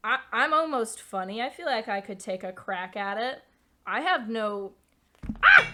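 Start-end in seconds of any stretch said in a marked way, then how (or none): 5.4-5.7 sharp startled shout with no words; sudden loud yelp. Real, not acted. Streamer startled mid-sentence on stream, sudden loud yelp. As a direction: blurt a loud surprised yell. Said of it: "that was probably really loud".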